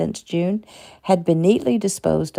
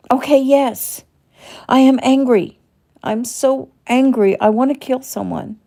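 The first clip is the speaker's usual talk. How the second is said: higher, louder, rising fury; angry outburst; 7 semitones higher, 5 dB louder than usual. Acted. Shelley confessing her rage to the doctor, angry outburst. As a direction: let the held-back anger burst out.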